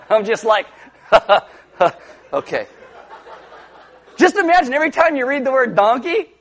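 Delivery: laughing through the words. Real, not acted. Preacher laughing to congregation at 'smote his ass'. Laughing at King James wording.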